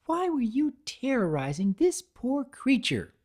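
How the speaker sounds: high-voiced firm demand. A narrator's reading. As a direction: insist on an answer. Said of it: "she demanded".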